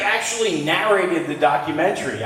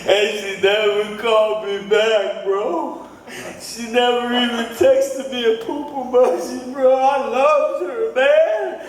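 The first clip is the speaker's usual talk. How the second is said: put-on higher, slow drunken lament, half sung; drunk heartbreak; 7 semitones higher, slower than usual. Drunk comic in the video, sing-whining about a lost love. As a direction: whine about the girl who left. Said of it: "Hour five".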